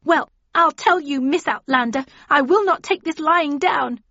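high, loud, indignant threat; furious high-pitched defiance. A narrator's reading. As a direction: declare it shrilly at the rival, outraged. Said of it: "anger and then fear as her voice rose".

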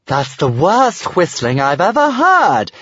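high, slow, drawn-out dragon voice; amused exclamation. A narrator's reading. Stormfly mocks the Vikings' whistling, amused exclamation. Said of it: "exclaimed Stormfly ... in amusement".

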